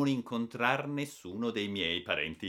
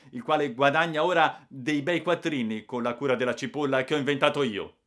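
louder, higher angry protest; heated outburst; 4 semitones higher, 6 dB louder than usual. Acted. Boy defending himself to the adults, loud and heated. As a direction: rant it back defiantly. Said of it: "e scattai".

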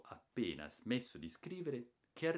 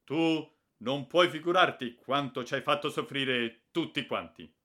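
higher, louder fatherly reproach; father scolding; 6 semitones higher, 11 dB louder than usual. A narrator's reading. Father reproaching the runaway boy, loud scolding. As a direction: tell him how much he made everyone suffer. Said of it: "mi ha detto".

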